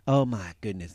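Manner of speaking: usual speaking voice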